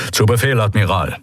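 ordinary delivery

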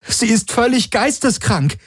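high-pitched outraged call; appalled cry. A narrator's reading. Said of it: "rief Kirai".

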